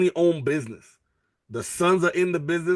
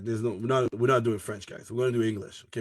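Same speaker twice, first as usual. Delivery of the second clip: ordinary talk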